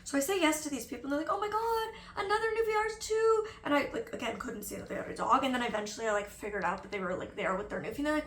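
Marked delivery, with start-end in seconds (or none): 1.3-3.5 high-pitched imitated delight; gushing dog owners. Retelling a couple greeting a fellow newfie owner, gushing. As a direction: imitate strangers thrilled at a match, high up.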